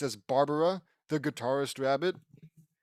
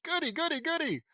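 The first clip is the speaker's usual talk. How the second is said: put-on very high, childlike delight; gleeful squeal; 13 semitones higher, 3 dB louder than usual. Character thrilled about the new rabbit friends, high gleeful squeal. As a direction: squeal it in rapid excitement.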